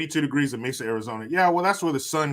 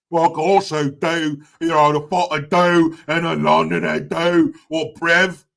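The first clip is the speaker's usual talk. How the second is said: loud mock London street accent; unattractive London accent; 2 semitones higher, 7 dB louder than usual. Shown as a demonstration. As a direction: show off an exaggerated, ugly London accent. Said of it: "one of the most unattractive accents in the world".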